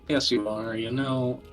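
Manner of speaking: normal talking voice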